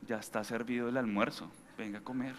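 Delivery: soft, low, kind voice; gentle invitation. Retold or imitated. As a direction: invite them to eat kindly. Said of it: "ir a la habitación".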